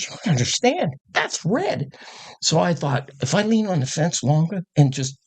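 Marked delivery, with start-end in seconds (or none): 0.0-1.9 high-pitched urgent explanation; imitated urgent bystander. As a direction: play the awed onlooker, voice pitched up high. Bystander's urgent answer retold.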